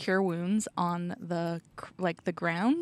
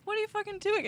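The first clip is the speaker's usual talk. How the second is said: healer voice; 14 semitones higher, 5 dB quieter, much faster than usual. Pretend. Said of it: "be like".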